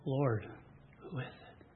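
hushed, breathy question; John leaning back, whispering to Jesus; quietly. Acted. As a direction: ask it under your breath. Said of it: "he says to him quietly".

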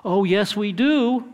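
put-on a little girl confidently contradicting her dad; young daughter. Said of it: "my daughter".

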